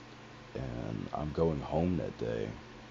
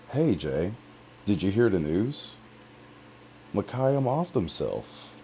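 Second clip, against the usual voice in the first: choked up; 7 semitones higher, 6 dB louder than usual. Retold or imitated. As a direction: voice choking on the news, rising.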